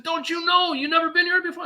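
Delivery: high-pitched urgent worker voice; anxious warehouse worker. Retold or imitated. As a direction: play the jittery guard warning him off. Worker's voice in retold story.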